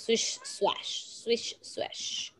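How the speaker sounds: breathy hushed swish swash, very soft; hushed swishing sound. Shown as a demonstration. Teacher showing children a tail wag with a hushed swish. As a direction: whisper the wag like a brushing tail.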